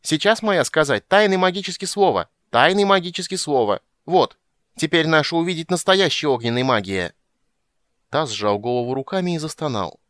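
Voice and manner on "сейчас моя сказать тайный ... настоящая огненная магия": high, loud creature proclamation; triumphant little creature shouting; full-throated yelling. A narrator's reading. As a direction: bellow the magic claim in a high voice. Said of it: "она завопила, что есть мочи".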